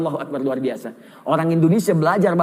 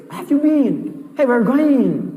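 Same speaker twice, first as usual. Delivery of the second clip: high-pitched put-on English accent; 4 semitones higher, much slower than usual. Shown as a demonstration. Preacher shows off sounding like the English, exaggerated English accent. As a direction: imitate a native English speaker, pitched up. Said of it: "Bahasa Inggris seperti orang Inggris".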